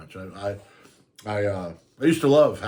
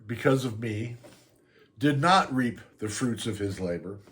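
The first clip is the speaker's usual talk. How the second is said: ordinary talk, nothing marked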